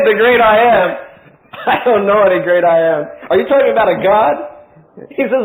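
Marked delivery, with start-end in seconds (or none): 0.0-4.3 loud, scoffing laugh through the words; mocking laugh. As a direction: laugh off the great I Am. Pharaoh mocks Moses' God.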